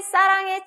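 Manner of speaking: 싸랑해 with tense double s, high and loud; high, forceful 싸랑해, first s tensed; tense ss demo. Shown as a demonstration. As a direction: show 싸랑해 with a hard ss. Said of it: "시옷과 쌍시옷과 치읓의 구분".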